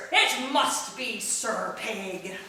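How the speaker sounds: pirate storyteller reciting verse; pirate poem reading. Acted. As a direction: recite it like an old sea dog. Reads pirate Christmas poem.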